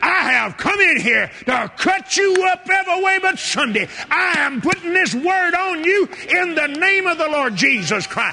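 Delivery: high, loud preacher's rebuke; retold rebuke. The preacher rebuking the devil, shouting high. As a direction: shout the rebuke as he did then.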